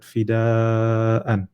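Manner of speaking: "fida'an" with a long steady aa before an; sustained recitation-style "fida'an"; pulled vowel. Shown as a demonstration. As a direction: really pull the middle of "fida'an". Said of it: "notice how you have to pull that".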